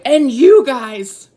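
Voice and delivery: loud drawn-out telling-off; big comic reprimand; mock scolding God. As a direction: playfully lay into them, loud and high. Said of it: "um, he's like".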